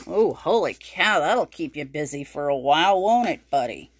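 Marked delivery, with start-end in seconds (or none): none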